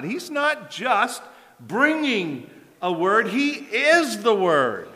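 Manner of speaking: drawn out, high and deliberate; slow emphatic point. Real, not acted. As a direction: let each word land slowly. Preacher slows for climax.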